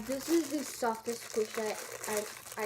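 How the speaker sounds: usual speaking voice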